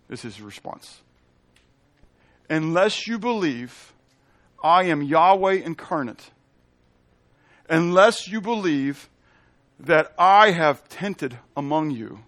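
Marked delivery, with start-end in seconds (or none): 2.5-12.2 slow, weighty proclamation; solemn pulpit warning; preaching. Acted. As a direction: press each phrase home. Slow emphatic paraphrase.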